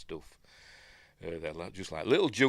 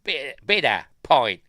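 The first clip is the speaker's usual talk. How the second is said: loud, high mock Australian accent; Australian-sounding English accent; 6 semitones higher, 11 dB louder than usual. Mimicking the film's Santa ordering, mock Australian accent. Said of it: "Sounds more Australian than bloody English".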